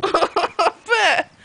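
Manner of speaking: amused "opa" through a laugh. Real, not acted. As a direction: laugh it out as she wobbles. Laughing as toddler wobbles.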